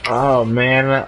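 usual speaking voice

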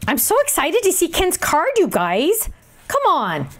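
high, eager gush; excited. Real, not acted. Crafter tells viewers about Ken's card, excited gush. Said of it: "I'm excited".

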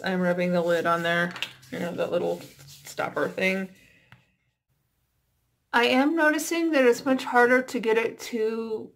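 face covered by wet mask; talking with mask on; through a sheet mask. Real, not acted.

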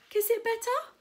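high, loud shouted offer. Acted. Max offering Preston comfort in the surf, high shout. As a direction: call it out brightly over the waves. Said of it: "shouted Max".

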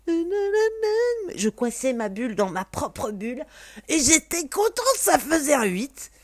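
dreamy singsong. Retold or imitated. Describing her contented bubble, dreamy singsong. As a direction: float it like a happy daydream. Recalling a dreamy happy moment.

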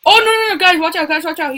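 panicked yelling; frantic high shout at teammates; raging gamer. Real, not acted. Panicked warning during fight.

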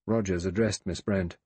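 ordinary delivery

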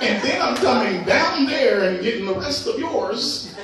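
laughing through the words; laughing bird. Retold or imitated. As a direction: cackle it out as the gloating bird. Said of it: "the bird's probably laughing like".

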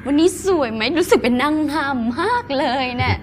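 high, sweet put-on Korean dubbing voice; chirpy mock-Korean self intro; cutesy dubbed Korean Thai voice; Korean-style cute voice. Shown as a demonstration. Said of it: "เสียงเกาหลีกำลังมาแรง".

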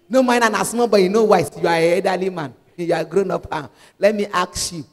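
ordinary delivery

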